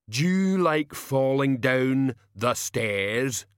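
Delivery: slow, odd hulking voice; rumbling brute. Acted. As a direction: menace like a slow giant. Banjo's threat.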